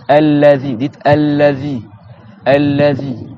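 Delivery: allazi with tongue between the teeth; drawn-out allazi, th on the tongue; interdental th in allazi. Shown as a demonstration. Teacher has pupils repeat allazi, demonstrating the tongue-tip th. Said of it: "tu vas mettre ta langue".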